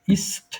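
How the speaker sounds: "ইস্ট" with a hissed S before T; unvoiced S. Shown as a demonstration. Teacher shows student S inside a syllable, S sound. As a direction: model "ইস্ট" with S, not Z. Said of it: "তো ছয়ের মতন হলো".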